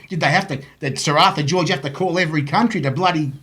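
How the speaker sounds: usual speaking voice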